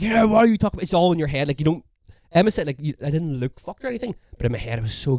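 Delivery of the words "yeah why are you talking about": put-on mildly raised, higher drunk challenge; drunken confrontation. Drunk person challenging people they think are talking, confrontational. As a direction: challenge them like a paranoid drunk. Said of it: "like when you're drunk".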